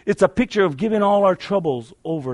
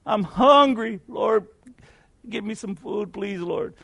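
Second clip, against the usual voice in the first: put-on pleading old woman; 5 semitones higher, slower than usual. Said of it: "the lady's still praying".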